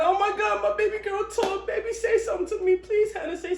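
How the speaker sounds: high, eager pleading; excited begging dad. Retold or imitated. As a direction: beg the baby girl to talk, thrilled. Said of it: "he's so excited".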